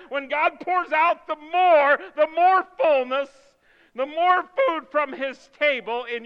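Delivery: loud, climbing preacher's cry; preaching at full force. Real, not acted. Pastor urging the church to find courage, fervent preaching. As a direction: lift the congregation with rising fervor.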